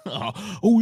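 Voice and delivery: ordinary talk, nothing marked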